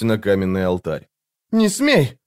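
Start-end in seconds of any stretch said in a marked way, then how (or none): 1.5-2.2 very high startled cry; high, shocked girl's exclamation; gasped alarm. A narrator's reading. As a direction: forbid it in alarm, pitch leaping up. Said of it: "ахнула девушка".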